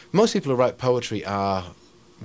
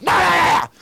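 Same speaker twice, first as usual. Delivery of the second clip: high, loud yell; sudden imitated screech; imitated involuntary outburst; 9 dB louder than usual. Poet imitates boy at dinner table, loud yelled outburst.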